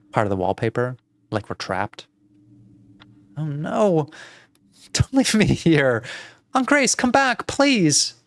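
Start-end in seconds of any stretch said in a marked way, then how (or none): 3.4-8.1 put-on high, desperate little-girl plea; distressed cry, climbing higher; pleading girl. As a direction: call your aunt back, begging. Plays abandoned girl calling after aunt.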